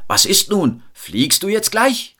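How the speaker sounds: high, loud, impatient shout; impatient annoyed call. A narrator's reading. The impatient crowd shouts up to Till, annoyed. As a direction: demand it impatiently from below. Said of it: "riefen verärgert".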